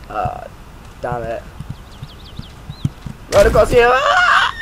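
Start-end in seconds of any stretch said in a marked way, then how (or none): 3.3-3.9 high, loud, rising yell; urgent shout. Real, not acted. Gamer dashing across open ground, urgent shout. Runs exposed across map. 3.9-4.5 very high shriek; panicked scream. Real, not acted. Gamer dashing across open ground, screaming.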